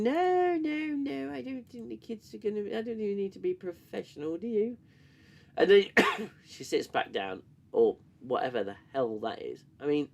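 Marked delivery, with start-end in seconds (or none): 0.0-4.8 high sing-song mock of the captain. Reviewer mocking the captain's answer to the kids, high mock voice. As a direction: needle her as a prissy captain, pitched up.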